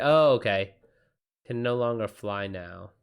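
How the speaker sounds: ordinary delivery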